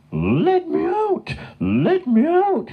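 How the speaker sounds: very high, drawn-out cry; mooing at top voice. A narrator's reading. Gertrude shut in the shed, frantic cries. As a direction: wail it slowly like a frantic cow. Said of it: "still mooing at the top of her voice".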